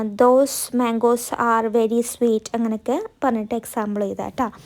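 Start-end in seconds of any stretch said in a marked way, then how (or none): 0.0-2.4 each word spaced for writing; slow dictation. Shown as a demonstration. Teacher dictating a sentence to students, very slow. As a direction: dictate slowly so students can copy. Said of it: "ആ".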